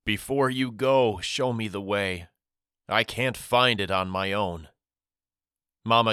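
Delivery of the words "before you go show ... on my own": plain reading voice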